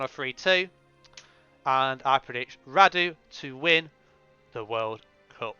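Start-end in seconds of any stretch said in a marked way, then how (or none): none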